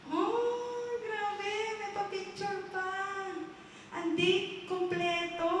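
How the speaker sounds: high, drawn-out wonder; acted amazement. Man finding his happy memories on file, amazed.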